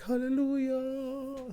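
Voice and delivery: high, slow sung-out hallelujah; mock hallelujah. Parent joking to a friend, high drawn-out hallelujah. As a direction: stretch it high like a church choir. Parents rejoice baby slept.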